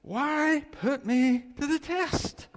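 high, slow, pointed challenge; Jesus challenging. Retold or imitated. Jesus to the questioners, high slow challenge. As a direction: call out the trap, drawn out. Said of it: "Jesus said to them".

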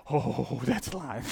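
drawn-out oh of sudden awe; awed alarm. Acted. Preacher imagining a lion in the jungle, awed alarm. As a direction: react to a lion on the loose, mildly acted. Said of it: "your attention span changes".

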